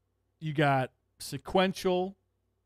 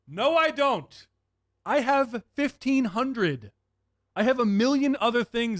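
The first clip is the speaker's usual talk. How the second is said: annoyed, higher pitched no thanks; exasperated retort; 8 semitones higher than usual. Real, not acted.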